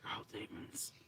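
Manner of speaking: whispered, breathy spooky aside; mock spooky whisper. Friend whispering a spooky reply about demons. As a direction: hiss it like a ghost story.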